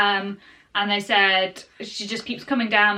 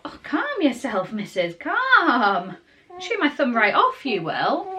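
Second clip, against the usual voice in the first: playful soothing; 5 semitones higher, slower than usual. Real, not acted. Mother to baby chewing her thumb, playful soothing.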